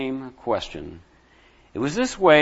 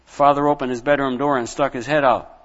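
usual speaking voice

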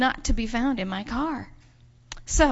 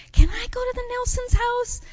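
put-on high, louder child's voice asking permission; pleading little girl; 13 semitones higher, 5 dB louder than usual. Girl asking her mom to visit the Nelsons, high and pleading. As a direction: put on an eager high kid voice.

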